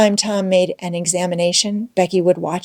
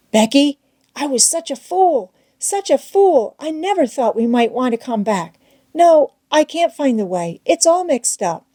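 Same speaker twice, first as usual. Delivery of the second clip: Tom pitched up, blaming himself; raised, self-reproaching Tom; despairing Tom; 7 semitones higher than usual. A narrator's reading.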